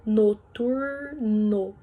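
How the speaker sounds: "Noturno" said very slowly, syllables pulled apart. Shown as a demonstration. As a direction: drag out "Noturno", lengthening the final o. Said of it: "ficam em sílabas separadas". ("Noturno" bem devagar, separando as sílabas)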